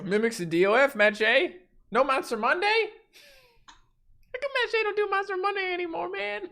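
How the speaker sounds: high voice climbing to a thin squeal; mock whiny viewer. Mimics nagging viewers in chat.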